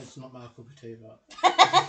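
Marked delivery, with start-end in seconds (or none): 1.4-1.9 squeak. Pretend. Squeaky punchline after pun.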